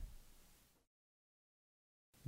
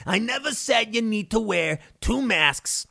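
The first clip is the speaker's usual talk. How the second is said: higher, lightly put-on denial voice; mocking Fauci denial; 6 semitones higher than usual. Mocking Fauci on TV denying his earlier advice. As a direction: deny it with fake innocence. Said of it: "Fauci goes".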